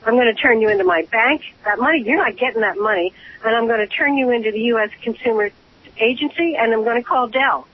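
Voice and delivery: normal talking voice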